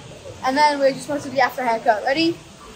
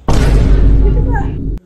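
excited breathy look; 8 semitones higher, 10 dB louder, much slower than usual. Real, not acted. Showing off haircut.

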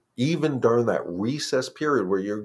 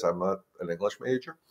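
whispered aside; 6 dB quieter than usual. Real, not acted. Joking to viewers about his grammar, whispered. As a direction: whisper it, a little sheepish.

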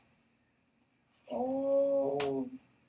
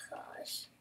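whisper; 17 dB quieter than usual. Real, not acted. Sighing to self.

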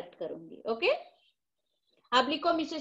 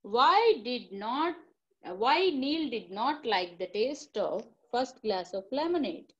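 slow dictation; much slower than usual. Shown as a demonstration. Dictating the question again.

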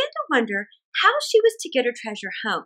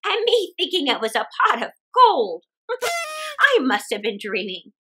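put-on chatty old woman thinking aloud; cheerful old woman. Old woman muses over the pot in the ditch.

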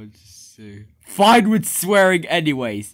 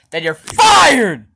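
angry threat; 6 semitones higher, 5 dB louder, slower than usual. Acted. Boss threatens to fire worker.

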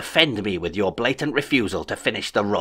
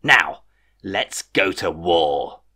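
slow, savoured menace; villainous relish; 3 semitones lower, much slower than usual. A narrator's reading. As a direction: linger over it like a gleeful tyrant. Kelvin launches the war.

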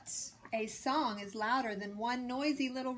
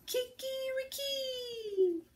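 rooster crow; slower than usual. A narrator's reading. Rooster crows in story.

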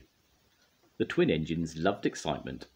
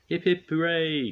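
much higher, louder happy cheer; 12 semitones higher, 4 dB louder than usual. A narrator's reading. Workmen cheering Bill and Ben, higher louder cheer. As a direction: cheer the heroes. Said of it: "cried Edward and all the workmen".

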